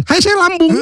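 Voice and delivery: high squeaky belly voice; stomach voice. Pretend. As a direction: speak as a talking stomach. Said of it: "Suara perut gitu kan".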